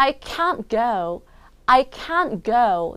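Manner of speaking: "can't" held long and prominent, T barely there; stress on CAN'T. Shown as a demonstration. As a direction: lean on "can't" before "go". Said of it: "요거를 좀 크게 읽어줘야". ("can't"를 크고 길게 강조)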